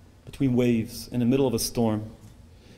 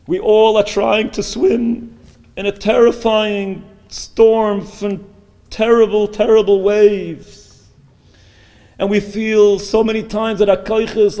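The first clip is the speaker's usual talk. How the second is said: loud, raised voice, stretching key words; impassioned preaching; 8 semitones higher, 10 dB louder, slower than usual. Real, not acted. Preacher to parents struggling with children, impassioned preaching. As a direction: press it on them with heavy fervour.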